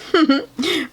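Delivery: short bright laugh. Real, not acted. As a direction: laugh out loud at the joke. Reader laughs at the scene.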